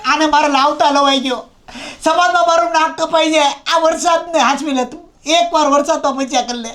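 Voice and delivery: high, whining begging; grovelling plea. Acted. Husband begs wife to save his face before friend, grovelling plea. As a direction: plead pitifully, voice pushed up high.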